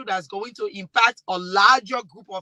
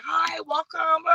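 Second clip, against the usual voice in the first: mock shop greeting; 6 semitones higher, slower than usual. Mimicking shop staff greeting.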